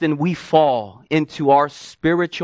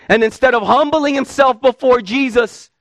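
usual speaking voice

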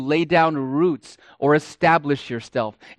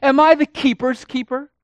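much higher and louder sneer, rising; cynical sarcasm; 10 semitones higher, 6 dB louder than usual. Acted. Cain sneering at God, high and loud. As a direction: throw it out with puffed-up pride. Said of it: "cynicism and sarcasm in his voice".

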